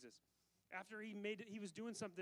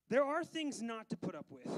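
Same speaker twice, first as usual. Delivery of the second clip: loud; 3 semitones higher, 10 dB louder than usual. Real, not acted. Preacher turns to warning.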